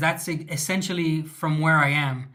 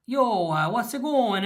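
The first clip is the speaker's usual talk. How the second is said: quick mock of a New York Italian American accent, pitched up; 6 semitones higher than usual. Mocking New Yorkers to his stream in a light New York Italian American accent. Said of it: "people in New York speak Italian American".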